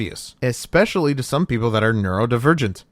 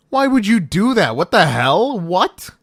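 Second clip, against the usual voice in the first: higher, louder outraged questions; appalled disbelief; 7 semitones higher, 4 dB louder, slower than usual. Shown as a demonstration. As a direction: demand an explanation, shocked.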